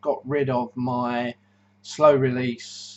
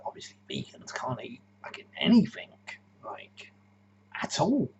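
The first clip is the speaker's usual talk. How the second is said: a sly, voiceless whisper aside; 8 semitones higher, 4 dB quieter than usual. Acted. Vlogger confiding his jab at vegans, whispered. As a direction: whisper the rude bit under your breath.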